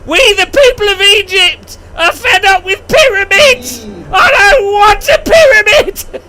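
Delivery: hammed-up sobbing yell of revolt; fed-up people. People of Egypt protesting against the pharaoh, hammed-up wailing shout. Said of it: "they're like".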